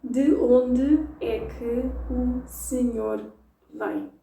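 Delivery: very slow, word by word; De onde é que o senhor vem split into separate words; robotic slow reading. Shown as a demonstration. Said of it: "this is robotic".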